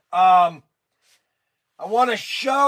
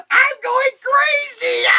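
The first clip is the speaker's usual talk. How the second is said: much higher and louder, slow; frenzied, slowed shriek; screaming; 5 dB louder, slower than usual. Pretend. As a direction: scream it like you're losing it. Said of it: "try to scream as loud as I can".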